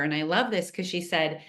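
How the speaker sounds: ordinary delivery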